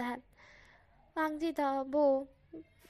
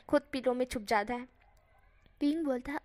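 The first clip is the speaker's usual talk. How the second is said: ordinary delivery, nothing marked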